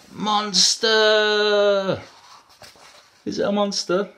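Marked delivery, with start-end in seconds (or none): 0.1-2.0 loud, high, drawn-out storybook scare; spring the scare on young listeners; dramatic reveal. A narrator's reading. Storyteller revealing a scary monster, loud and dramatic.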